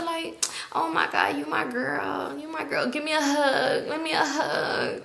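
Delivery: slow, soft whimpering plea for a hug; mock drunk whine. Clingy whining for a hug, soft slow whimper.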